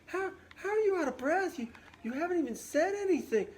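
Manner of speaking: exasperated Juliet. Retold or imitated. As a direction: burst out in disbelief, fast. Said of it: "And Juliet's like".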